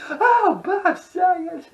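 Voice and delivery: loud high-pitched mock wail; Tourette's guy catchphrase. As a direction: shriek the name as you crack up. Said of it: "He's like".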